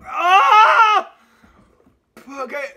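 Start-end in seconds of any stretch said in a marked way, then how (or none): 0.0-1.1 loud, higher yell half laughing through the burn; pained laughing yell. Real, not acted. Vlogger yelling from burning lips, laughing yell. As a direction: cry out, laughing at your own pain.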